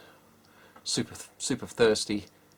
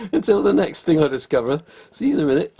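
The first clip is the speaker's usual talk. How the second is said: laughing sign-off; 7 semitones higher, 6 dB louder than usual. Real, not acted. Signing off after the discovery.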